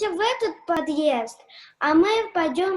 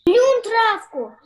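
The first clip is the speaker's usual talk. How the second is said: higher, slow scolding call; higher slow scolding of a dog; 5 semitones higher, 6 dB louder than usual. Acted. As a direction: tell the dog off loudly. Scolding dog eating grass.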